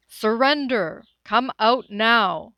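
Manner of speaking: booming, slow commanding shout; megaphone shout. Acted. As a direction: bark it through a megaphone. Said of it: "magnified by a megaphone, boomed".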